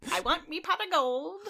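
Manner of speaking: high, put-on Irish leprechaun voice; Irish leprechaun accent. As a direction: growl it like a greedy leprechaun. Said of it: "hit us with a leprechaun quote".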